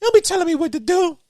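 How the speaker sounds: high scolding character voice; mock scolding. A co-host voices the woman scolding her man, high-pitched.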